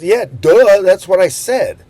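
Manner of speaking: put-on loud, high, snappy old-lady "duh"; a mock-indignant old lady shouting her answer; sassy retort. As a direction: throw the "duh" back at him, loud and high. Sassy old lady's retort quoted.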